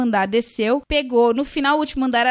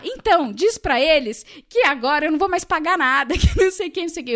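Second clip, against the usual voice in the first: higher-pitched, worked-up demand with laughter; hammed-up tirade at a manager; retold ranting; 5 semitones higher than usual. Angry guest ordering her translator at reception, retold ranting. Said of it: "era só você a única gritando".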